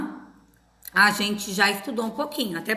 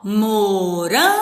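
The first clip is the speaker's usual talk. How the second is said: "morango" slow, with RAN stretched as one syllable; stress on RAN; 5 dB louder, slower than usual. Shown as a demonstration. Teacher showing how we read it, "morango" with a long RAN. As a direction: read "morango" out slowly, holding the middle. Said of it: "é conforme a gente lê".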